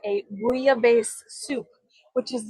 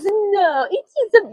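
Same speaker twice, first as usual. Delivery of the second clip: retold reply; 8 semitones higher, slower than usual. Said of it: "she goes".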